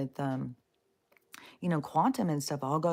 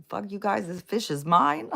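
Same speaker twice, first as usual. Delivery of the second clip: mock Jesus; 5 semitones higher, 6 dB louder than usual. Mocking Jesus hoarding fish.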